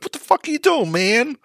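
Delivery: high-pitched put-on American accent. Podcaster imitating an American whose hat got slapped, high American accent. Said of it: "that's my American accent".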